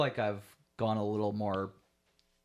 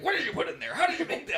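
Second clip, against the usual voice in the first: high, loud mock-alarmed dad; imitating dad's frantic questioning; chasing dad; 5 dB louder, faster than usual. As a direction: demand answers in a very high voice. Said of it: "My dad's chasing me like".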